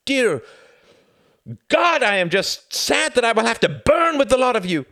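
loud, high furious outburst; furious shout. Acted. Son shouting at his mother in fury. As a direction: rail at her at full force.

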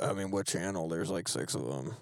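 low, quiet deadpan Josh; imitating Josh. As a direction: mumble it low like an unimpressed Josh. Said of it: "Josh is over here like".

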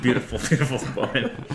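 laughing through the words; giggling as you describe her. Real, not acted. Laughing while narrating.

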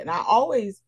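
ordinary delivery

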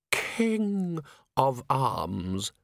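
very drawn-out, stately title; chilly, precise voice. A narrator's reading. Ancient herald announces his title from the shadows, chilly. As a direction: intone it coldly, word by word. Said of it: "said the voice of Dragon".

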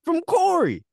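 loud, very high disbelief; incredulous outburst. Retold or imitated. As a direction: shoot the voice way up in mock outrage. Baffled outburst over name mix-up.

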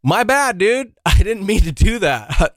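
high-pitched, reenacted backing down; mock panicky oops; startled apology. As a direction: back off fast, voice shooting up. Acts out apologising to angry kid.

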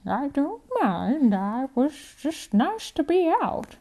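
put-on an old woman brushing it off lightly; sweet elderly lady, slow and high. Grandma Jo voiced, gentle.

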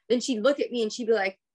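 normal talking voice

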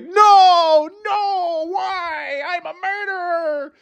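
very high, hammed-up despairing wail; mocking the movie guy's despair as the baby goes to hell. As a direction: wail like a cartoon sinner doomed. Guy wailing as baby goes.

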